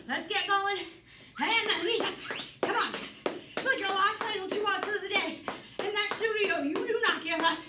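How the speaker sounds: loud rallying coach; aerobics instructor. Acted. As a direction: push through the final round. Final round push.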